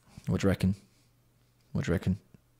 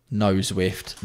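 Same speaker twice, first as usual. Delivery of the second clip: ordinary talk, nothing marked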